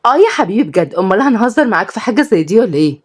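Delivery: mock sweetly at your brother; sarcastic daughter. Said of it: "ردت ليلى". (صوت بنت ساخرة بنبرة أعلى)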